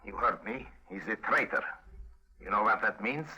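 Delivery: put-on Russian agent's accent; best Russian accent. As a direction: denounce him in a Russian accent. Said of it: "in my best Russian accent".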